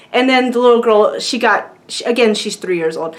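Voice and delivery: normal talking voice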